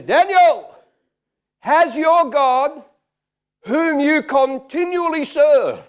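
high, loud, drawn-out royal cry; king calling out. Acted. King calls down to Daniel, hammed-up shout. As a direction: holler slowly down into the lions' den.

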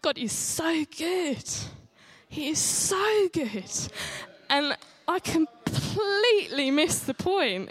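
slow, high drawn-out praise; slow high fervent testimony; slow, fervent emphasis. Real, not acted. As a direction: savour each word slowly.

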